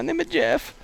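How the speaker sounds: high slow character voice; Steven impression. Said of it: "I can do Steven a little bit".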